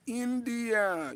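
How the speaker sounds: pleased surprise at where the viewer is; delighted discovery of the viewer's country; surprised. Real, not acted. Reads viewer's country from chat.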